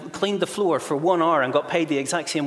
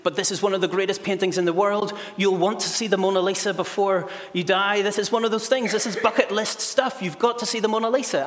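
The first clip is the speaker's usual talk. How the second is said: higher-pitched, urgent pleading; protesting; 6 semitones higher than usual. Acted. Mary and Claire beg the others to stay, protesting. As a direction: argue insistently, pitch climbing as you go. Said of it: "Mary and Claire protested".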